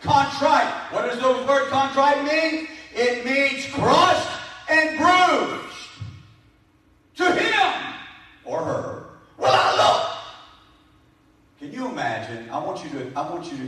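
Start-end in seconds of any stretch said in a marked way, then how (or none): 0.1-10.2 high, intense pulpit delivery; full-force preaching. Acted. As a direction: drive each definition home to the congregation.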